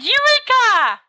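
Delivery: high, slow triumphant shout; eureka cry. Acted. Sirius quoting the bloke who shouted eureka, high and loud. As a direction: cry it out like a discovery.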